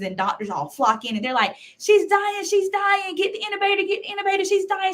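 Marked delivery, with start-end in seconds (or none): 1.8-4.9 urgent, high-pitched panic; frantic shouting. Retold or imitated. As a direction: staff yelling that she's crashing. Medical team shouting in emergency.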